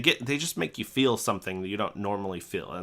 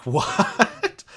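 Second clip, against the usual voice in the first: high, loud incredulous outburst; stunned disbelief; 11 semitones higher, 6 dB louder than usual. Retold or imitated. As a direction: act out being utterly blindsided. Retold shock at threat of arrest.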